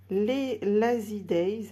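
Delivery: slow English words in a French accent; drawn-out English name, French-accented. Real, not acted. Presenter reads an English set name to viewers, French accent. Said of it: "Excusez-moi pour mon, mon accent".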